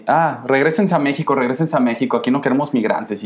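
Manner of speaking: normal talking voice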